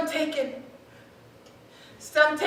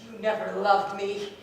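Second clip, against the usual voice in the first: low bitter accusation; 9 semitones lower, 8 dB quieter than usual. Acted.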